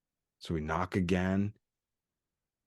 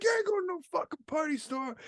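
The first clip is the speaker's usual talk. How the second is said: very high, softer mock scolding; imitated angry cabbie; 5 dB quieter than usual. Cabbie hearing they want a party store, high mock scolding. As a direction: refuse them in a high put-on cabbie voice. Retold cabbie refusing their request.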